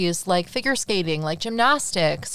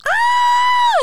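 very high, loud squeal; delighted squeal; 8 dB louder than usual. Real, not acted. Listener hearing the sweet confession, delighted squeal. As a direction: squeal long and high over the romance. Reacting to a romantic line.

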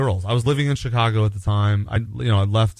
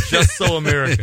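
louder mock movie-trailer announcement; grand title announcement; 3 semitones higher, 5 dB louder than usual. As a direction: proclaim it like a book title on a cover. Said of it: "That's the name of my autobiography".

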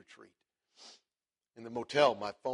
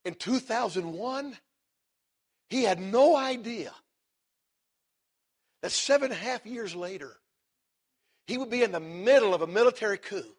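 preaching at full force; 9 semitones higher, 10 dB louder than usual. Acted. Preacher building to the lesson for the congregation. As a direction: drive the point home to the crowd.

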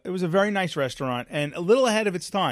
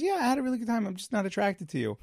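higher, airy imitation of the date; light mocking girl voice; mimicking her; 7 semitones higher, 3 dB quieter, faster than usual. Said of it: "She goes".

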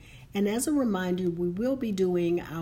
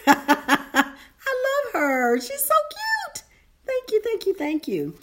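cooing; 8 dB louder, slower than usual. Real, not acted. Cooing at a cute emoji.

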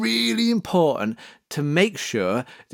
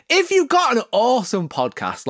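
normal talking voice

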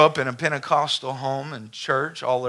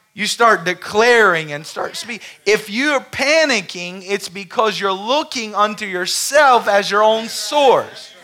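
preaching climax building louder and higher; building sermon; 6 semitones higher, 6 dB louder than usual. Acted. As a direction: build the exhortation to a peak. Preaching to the congregation.

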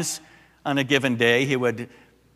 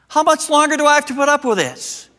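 much higher and louder, a fed-up cry; exasperated outcry; 14 semitones higher, 8 dB louder than usual. Retold or imitated. A preacher crying out his fed-up complaint to the congregation. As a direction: let the frustration burst out on "much".

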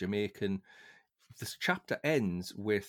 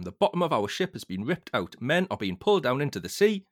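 loud, raised panicked report; a somewhat louder, higher panicked radio call; screaming; 4 semitones higher, 6 dB louder than usual. Acted. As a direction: keep yelling the news into the radio. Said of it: "Mason screamed in the microphone".

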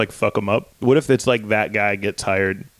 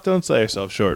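ordinary talk

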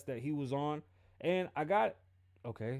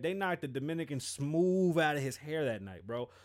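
ordinary talk

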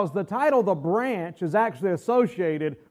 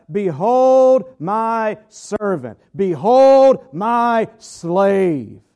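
very slow, louder Behold my servant, Behold my slave; slow proclamation; 6 dB louder, much slower than usual. Acted. Preacher proclaiming Christ's title to the class, very slow and loud. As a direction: stretch every word like a herald. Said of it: "proclaims the title slowly".

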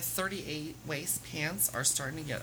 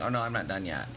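mock deep lover's voice, much lower; imitating her lover; 10 semitones lower than usual. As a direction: drop low to play the boyfriend insisting. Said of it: "He's like".

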